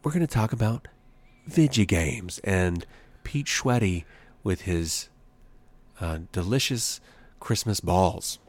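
low, slow, hushed radio-host delivery; drawn-out opening, then low and measured; mock public-radio host. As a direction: spoof a sleepy radio intro, long pauses between phrases. Spoofs public-radio hosting.

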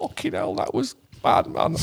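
high breathless whisper of disgust; whispered grimace. Retold or imitated. After the tea ceremony sweet, high whispered disgust. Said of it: "It's like".

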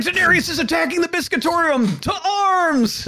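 alarmed call to arms. Retold or imitated. Panicked bakers call to arms.

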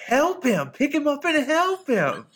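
put-on high, loud little-girl protest; little girl scolding her dad; imitating a little girl. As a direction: insist like a cross child. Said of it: "She says".

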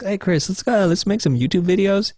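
high-pitched upbeat suggestion, imitating a pal; imitated friend's eager invitation. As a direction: pitch an idea like an enthusiastic friend. Friend eagerly pitches YouTube idea.

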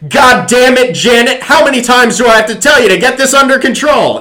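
hammed-up high, loud movie-cop shout; mock furious shout. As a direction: yell it like a cliche buddy cop storming in. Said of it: "Kicks open the principal's door and goes".

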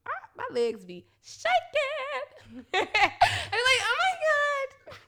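high, slow swooning voice; mock ecstasy. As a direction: ham up the pleasure. Acting out pleasure, high voice.

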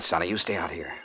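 soft, low instruction; hushed order. Acted. Detective ordering Sonny to wait outside, in a hushed voice. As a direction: give the order under your breath. Sneaking up on a cabin.

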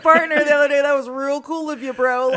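loud, mock-chummy holler; mock hearty greeting. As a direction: blurt it out loud like an overfriendly bro. Acted awkward greeting to partner's hookup.

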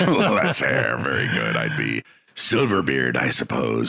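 low pirate voice with a laugh. Pretend. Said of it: "your pirate name".

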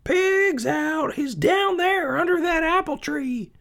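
very high, loud alarmed yelling; screaming farm wife calling for help; frantic shouting. Acted. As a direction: yell for the men from the kitchen. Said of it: "she cried".

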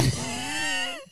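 squealing burst of laughter; a shrill laughing squeal; helpless high laugh. Real, not acted.

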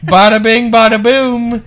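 high, loud "bada bing"; jokey flourish. Real, not acted. Host jokes about the couple. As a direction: punch it like a punchline.

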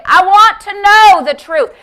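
high, loud impassioned demand; fervent shouted plea; crying out to God. Acted. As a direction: cry out boldly for the truth. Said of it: "crying out to God every day".

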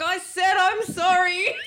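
high, loud, slow pleading from under the table; mock pleading. Footballer hiding under the table, high loud mock plea. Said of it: "he was like".

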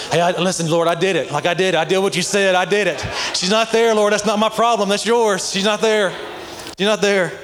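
high-pitched, insistent excuse-making; mock defensive protest. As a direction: argue your way out of it with the Lord. Acts out making excuses to God.

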